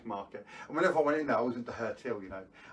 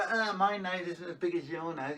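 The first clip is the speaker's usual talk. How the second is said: mock bully kid; 4 semitones higher than usual. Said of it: "they were being horrible to me".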